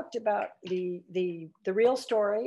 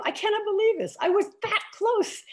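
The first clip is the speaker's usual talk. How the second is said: high and loud, still reeling from the near miss; exasperated disbelief; 6 dB louder than usual. Retold or imitated. Confiding to her husband after missing the story, exasperated. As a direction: tell your husband you can't believe you were that close.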